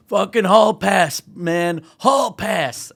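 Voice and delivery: loud, high celebratory holler; elated retold carouser. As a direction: crow it like the excited guy with the wine. Retold elated man with wine.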